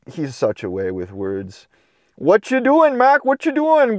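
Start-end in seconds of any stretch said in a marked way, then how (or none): 2.1-4.0 high, bouncy puppy voice; eager puppy. Acted. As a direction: pester him like an excited young pup. Puppy asks again, higher.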